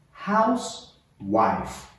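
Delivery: HOUSE, pause, WIFE, drawn out slowly; word split in two. Shown as a demonstration. Teacher models Housewife for learners to repeat, slowly split in two.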